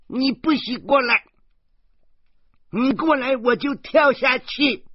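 put-on a desperate girl crying out from the railing; anguished child's cry. Girl on the railing threatening to jump, anguished cry. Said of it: "她悲怆地喊道".

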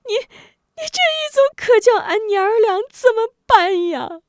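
high, loud keening sobs; wailing in grief. Acted. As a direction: howl in grief and curse them. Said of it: "吴夫人悲痛欲绝".

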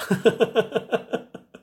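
rising laugh; high laugh. Real, not acted. Laughing about the coworker, high laugh.

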